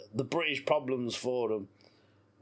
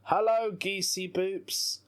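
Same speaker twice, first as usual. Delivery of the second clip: higher, slow sing-song hello; silly cooing greeting; 7 semitones higher, slower than usual. Pretend. Greeting geese in the park with her son, cooing. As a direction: coo it at the geese. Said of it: "I'm just gonna say".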